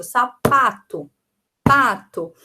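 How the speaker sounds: "sapato, pato" slowly, PA loud and drawn out; stressed rhyming syllable. Shown as a demonstration. Teacher showing rhyme by ear, slow stressed PA in "sapato, pato". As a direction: punch PA in "sapato", pause, then again in "pato". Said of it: "mas sim pela sílaba tônica".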